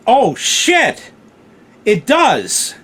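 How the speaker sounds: high, loud sudden alarm. Retold or imitated. Realising something is burning, loud alarmed outburst. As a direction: jolt into panic at the smell.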